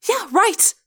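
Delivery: high, scornful snap; snapped retort. A narrator's reading. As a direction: cut him off sharply. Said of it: "Rachel snapped".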